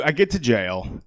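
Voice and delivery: normal talking voice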